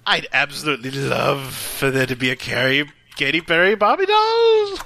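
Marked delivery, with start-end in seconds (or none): none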